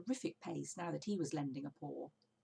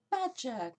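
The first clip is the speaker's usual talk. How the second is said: loud, higher surprised cry; cried out; 7 semitones higher, 7 dB louder than usual. A narrator's reading. Mister Fox greets old friend Badger, loud glad cry. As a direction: call out his name in surprise. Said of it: "cried Mister Fox".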